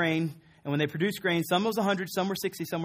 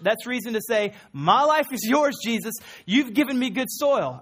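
higher and louder, slowing to exult; full-force preaching; 6 semitones higher, 4 dB louder than usual. Acted. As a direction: build to joyful celebration. Preacher builds to celebration.

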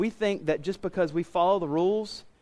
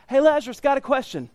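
mock call across; 8 semitones higher, 5 dB louder than usual. Preacher mocks man calling from hell.

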